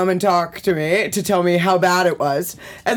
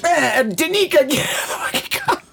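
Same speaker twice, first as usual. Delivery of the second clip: panicked retold yell; 6 semitones higher than usual. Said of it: "I was just like".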